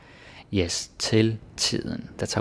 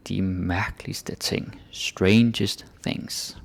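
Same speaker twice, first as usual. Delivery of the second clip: slow repeat; much slower than usual. Shown as a demonstration.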